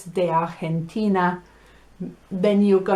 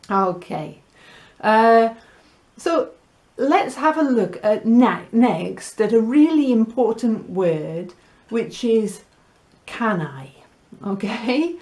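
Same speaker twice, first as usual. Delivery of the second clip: ordinary talk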